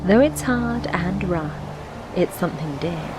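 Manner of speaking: ordinary delivery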